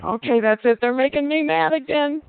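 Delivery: voicing a fed-up inner snap, half-acted; mock angry thought. Teacher voicing an angry parent's thought, mildly exasperated. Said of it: "Some people just start thinking".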